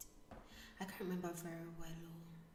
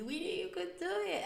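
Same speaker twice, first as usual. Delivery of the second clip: much higher, bright congratulation; cheerful congratulation; 12 semitones higher, 5 dB louder, faster than usual. Retold or imitated. Voicing supportive friends congratulating her, cheerful and much higher.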